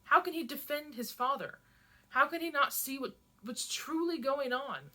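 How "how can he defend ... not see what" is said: ordinary delivery, nothing marked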